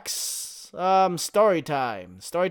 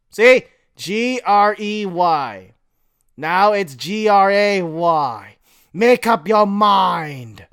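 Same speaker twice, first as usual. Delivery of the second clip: exasperated; 4 semitones higher, 8 dB louder than usual. Real, not acted. Complaining about spelling.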